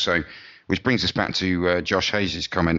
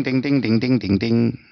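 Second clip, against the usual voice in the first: higher, sung-out banjo imitation; mock banjo twang; 4 semitones higher, 4 dB louder than usual. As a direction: imitate a twanging Deep South banjo riff. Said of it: "type Deep South stuff".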